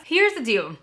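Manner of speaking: ordinary talk, nothing marked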